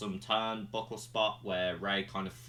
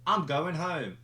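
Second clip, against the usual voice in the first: higher, impatient demand to the ref; impatient wrestler; 7 semitones higher than usual. Retold or imitated. Wrestler telling the ref to end the match, higher and impatient. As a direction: bark at the ref to hurry up.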